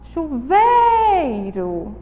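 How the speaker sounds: Chuveiro very slow, "vei" stretched out; stress on penultimate syllable. Shown as a demonstration. Teacher shows the strongest syllable, stressed "vei" in Chuveiro. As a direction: draw out the middle "vei" of Chuveiro. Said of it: "tem a penúltima sílaba".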